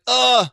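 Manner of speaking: loud, high disgusted groan; disgusted ugh. Retold or imitated. Pavlyuchenko reacting to the vomiting Modric, disgusted loud groan. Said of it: "And he's like".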